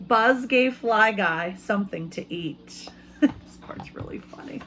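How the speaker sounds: normal talking voice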